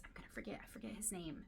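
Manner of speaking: muttered under the breath while searching memory; whispered aside. Real, not acted. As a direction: whisper it to yourself, trying to remember. Can't recall character's name.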